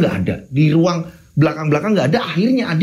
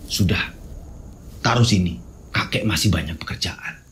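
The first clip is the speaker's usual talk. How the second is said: put-on low, gruff old man voice; a stern grandfather brushing him off; low-pitched old man demanding the thing; 9 semitones lower, 6 dB quieter than usual. Grandfather sends Adi home.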